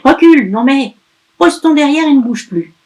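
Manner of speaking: loud, high scolding; angry witch. Acted. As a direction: order him off sharply. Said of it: "crie-t-elle".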